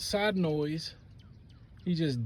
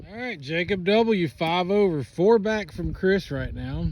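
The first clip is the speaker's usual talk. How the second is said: golf commentator; 3 semitones higher, 6 dB louder, slower than usual. Acted. Mock TV golf broadcast.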